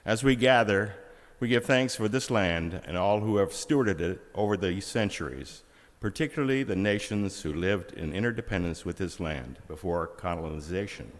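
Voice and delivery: usual speaking voice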